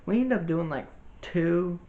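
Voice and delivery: normal talking voice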